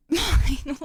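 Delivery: normal talking voice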